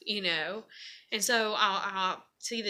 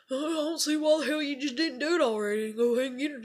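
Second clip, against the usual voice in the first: fast, high-pitched mock griping; complaining dad; 6 semitones higher, faster than usual. Dad bitching at his daughter, imitated griping. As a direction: imitate a father griping in one rush. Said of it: "he kept complaining".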